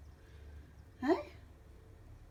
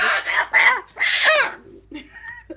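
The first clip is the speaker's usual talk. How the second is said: burst of laughter; 12 semitones higher, 6 dB louder than usual. Real, not acted. Laughing at the pet.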